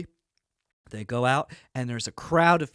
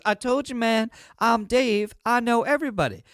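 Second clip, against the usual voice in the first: mildly exasperated, raised-pitch brag; insistent boast; 6 semitones higher than usual. Acted. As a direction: insist like a know-it-all proving a point. Dave boasting to doubting boss.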